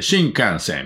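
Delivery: put-on 「新幹線」 with a stressed, foreign-sounding accent; foreigner's accent. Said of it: "みたいに言うじゃないですか".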